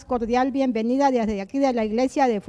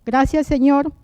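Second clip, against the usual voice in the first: fervent spoken prayer, a bit louder; earnest prayer; 5 dB louder than usual. Acted. Host leads the listeners in prayer, earnest prayer voice. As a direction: pray aloud on behalf of everyone.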